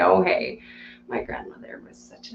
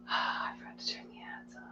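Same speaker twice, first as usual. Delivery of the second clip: whisper; 22 dB quieter than usual. Real, not acted. Confessing aside to stream viewers.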